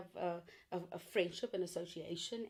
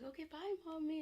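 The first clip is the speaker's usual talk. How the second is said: put-on high little boy's voice, calmed down; child saying bye; 7 semitones higher, 4 dB quieter than usual. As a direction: voice the son, higher and sweet. Child's voice in retold story.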